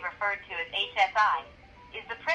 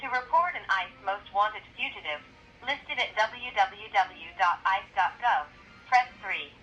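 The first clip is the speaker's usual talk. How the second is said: recorded phone menu over the line; automated hotline recording. Real, not acted. Automated ICE tip line call.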